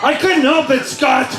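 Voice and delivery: high, laughing plea; mock protest. Teasing Scott from the stage, high mock protest.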